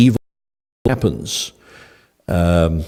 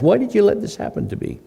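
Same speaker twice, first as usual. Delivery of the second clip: pained question to God; 13 semitones higher, 3 dB quieter than usual. Retold or imitated. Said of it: "I'm gonna ask".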